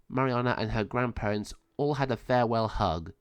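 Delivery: ordinary delivery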